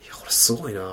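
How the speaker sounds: hideout-level hushed voice. Retold or imitated. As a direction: murmur it so the neighbour can't hear. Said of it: "この声量で喋んなきゃいけなかったから".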